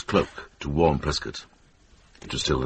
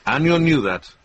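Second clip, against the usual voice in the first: higher and louder, driving it home; forceful point; 8 semitones higher, 5 dB louder than usual. Acted. Monk presses the lords with rising force. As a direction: hammer the point to the court.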